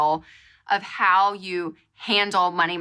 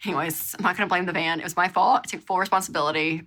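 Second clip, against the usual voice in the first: ordinary delivery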